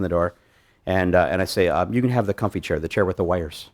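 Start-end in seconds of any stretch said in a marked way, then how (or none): 1.9-3.7 dead straight face, no hint of a joke; quiet deadpan offer of the comfy chair; deadpan serious. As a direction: sell the line totally serious. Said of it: "I say it deadpan serious".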